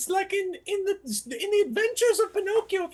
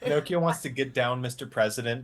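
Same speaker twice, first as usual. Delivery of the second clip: much lower put-on puppet voice; deep Pinocchio voice; 5 dB louder than usual. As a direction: growl it low as the puppet.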